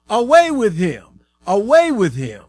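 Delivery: loud, high outcry; crowd shout. A narrator's reading. The crowd rejects the king Pilate shows, shouting. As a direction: shout it out as an angry mob would. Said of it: "But they shouted".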